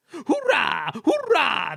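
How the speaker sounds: roaring cheer. A narrator's reading. Trucks roar as they hurtle down, loud high cheer. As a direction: cheer wildly as you break free. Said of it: "they roared".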